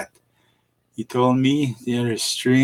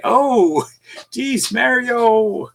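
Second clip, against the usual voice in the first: high, stretched-out exclamation; drawn-out exclamation; 11 semitones higher, much slower than usual. Retold or imitated. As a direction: call it out high and slow to a friend. Voices his own greeting to Mario.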